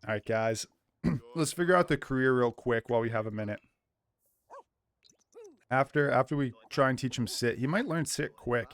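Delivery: ordinary delivery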